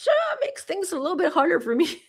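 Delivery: laughing. Real, not acted. Laughs about being perimenopausal.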